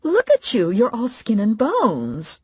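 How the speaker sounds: fussing mother. A narrator's reading.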